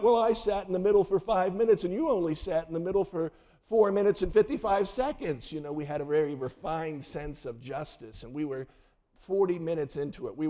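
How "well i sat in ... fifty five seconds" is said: put-on whiny kid. Kids bickering in back seat.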